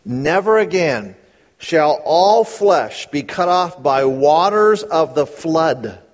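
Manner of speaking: slow, weighty reading stressing never; slow careful reading. Shown as a demonstration. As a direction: read slowly, leaning on never. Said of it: "Read it carefully".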